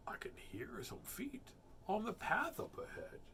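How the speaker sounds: anxious whisper. Acted. Gruffalo hearing feet ahead, whisper. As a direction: whisper a warning.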